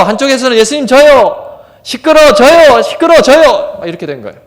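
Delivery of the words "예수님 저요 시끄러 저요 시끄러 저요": high, loud back-and-forth yelling; shouting match. Retold or imitated. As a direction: play both sides hollering over each other. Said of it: "한쪽에는 ... 한쪽에서는".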